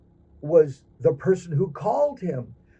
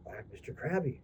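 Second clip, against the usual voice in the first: quiet crab voice; 12 dB quieter, faster than usual. Acted. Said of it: "He says".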